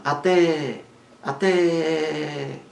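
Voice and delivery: "Até" with a high, long-held final té; short A, then a long ringing té in "Até"; stress on last syllable. Shown as a demonstration. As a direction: draw out the stressed end of "Até". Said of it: "Sílaba mais forte é a última".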